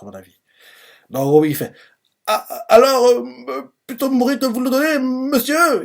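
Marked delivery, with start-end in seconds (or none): 2.3-5.8 tearful defiance. Retold or imitated. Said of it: "Il dit ça avec les larmes aux yeux".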